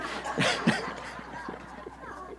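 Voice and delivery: breathy, unvoiced laugh. Real, not acted. Preacher amused by his chia seed digression, laughing.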